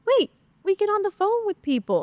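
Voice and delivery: high, startled disbelief; incredulous boss voice; retold surprise. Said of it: "And I was like".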